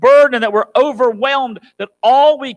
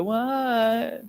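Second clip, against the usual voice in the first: offhand mom reply; 3 semitones higher, 7 dB quieter than usual. Retold or imitated. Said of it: "You may go".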